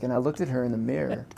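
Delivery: normal talking voice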